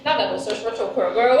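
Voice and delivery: ordinary talk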